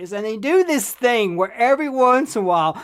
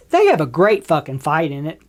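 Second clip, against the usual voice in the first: ordinary talk, nothing marked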